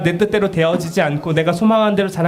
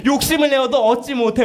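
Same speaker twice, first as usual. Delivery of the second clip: 욕심을 stretched, higher preaching restatement; emphatic preaching; 9 semitones higher than usual. Acted. Preacher restating the verse to congregation, higher emphatic. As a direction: drive home 욕심을 내어도 with lifted voice.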